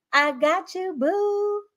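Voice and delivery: affectionate teasing repeat to a viewer; playful sing-song. Real, not acted. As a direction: toss it back sweetly, half laughing.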